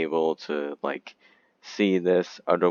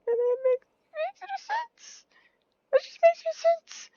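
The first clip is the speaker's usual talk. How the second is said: panicked squeal; 5 dB louder than usual. Acted. Panicking passenger at tsunami.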